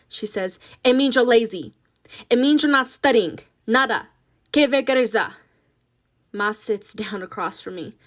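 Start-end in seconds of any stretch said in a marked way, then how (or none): 0.8-5.3 put-on loud, angry Spanish-accented scolding; berating mother. Ma berating Nina as lazy, loud and angry. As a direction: shame her, building heat.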